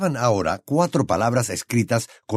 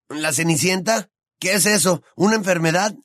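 put-on higher teasing boy's voice; higher young wizard voice; Ron's voice; 9 semitones higher than usual. A narrator's reading. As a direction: voice the gangly friend, amused.

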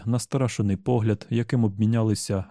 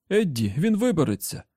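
higher urgent shout; alarmed higher yell; Tian shouting; 6 semitones higher than usual. Acted. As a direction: cry out a warning to Eddie. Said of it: "закричав Тіан".

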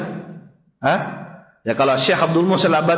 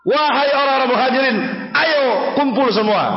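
high-pitched rallying call; acted summons, pitched well up; 7 semitones higher than usual. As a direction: imitate a man rounding up his whole clan. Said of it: "Yang satu tadi dipanggil juga".

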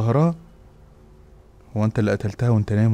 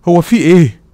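higher, louder alarmed cry; panicked question; 7 semitones higher, 10 dB louder than usual. Acted. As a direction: blurt it out in fear. Panicking at her pause.

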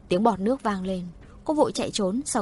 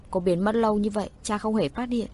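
plain reading voice

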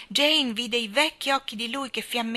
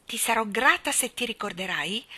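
ordinary delivery

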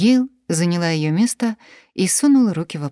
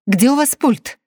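panicked demand; 8 semitones higher, 6 dB louder than usual. Acted. Panicked woman grabs remote.

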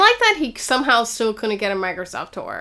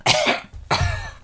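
forced, put-on cough; fake cough; 5 dB quieter than usual. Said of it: "that was a fake cough, mostly".

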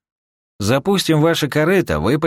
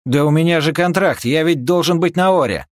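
high, louder engineer voice protesting; alarmed protest; 12 semitones higher, 5 dB louder than usual. Acted. Engineer objecting that he has a contract, alarmed protest. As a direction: protest urgently in a raised, high-pitched voice.

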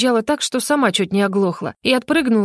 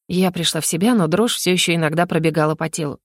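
ordinary delivery, nothing marked

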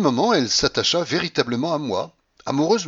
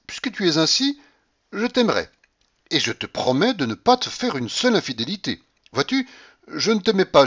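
higher, bright-eyed delighted mistress; lightly voiced courtesan in raptures; Blanche delighted; 7 semitones higher than usual. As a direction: gush over him, won over.

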